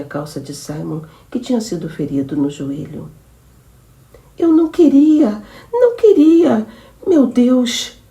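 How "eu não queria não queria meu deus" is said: much higher, loud, anguished cry; horrified wail. Acted. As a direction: stricken with horror at what she did. Woman horrified after shooting him. (lamento desesperado e agudo)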